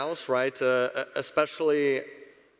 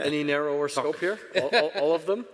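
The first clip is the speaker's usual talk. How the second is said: laughing; 3 semitones higher, 3 dB louder, faster than usual. Real, not acted. Laughing at the broad question.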